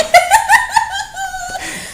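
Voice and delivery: long high burst of laughter; real, helpless high laugh. Real, not acted. Cracking up at the Spock line, high laugh.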